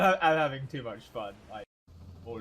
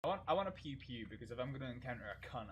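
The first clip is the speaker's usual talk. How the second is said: normal talking voice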